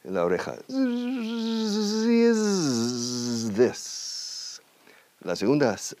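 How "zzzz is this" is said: long high mosquito zzz gliding into "is this"; drawn-out buzz leading into "is this". Shown as a demonstration. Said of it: "yo llamo de zumbido".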